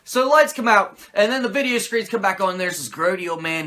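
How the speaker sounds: ordinary talk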